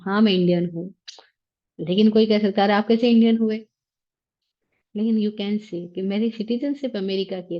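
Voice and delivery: normal talking voice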